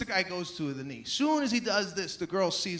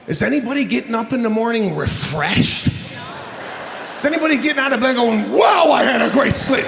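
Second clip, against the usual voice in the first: full-volume ranting delivery; loud comic rant; 11 dB louder than usual. Real, not acted. Comic rants to the crowd about bad sleep, loud. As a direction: blast it at the crowd.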